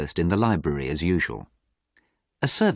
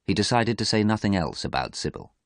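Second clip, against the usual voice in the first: plain reading voice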